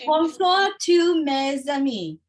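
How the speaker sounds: slow, careful American accent in French; halting French with an English accent. Real, not acted. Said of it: "here's my attempt to speak French".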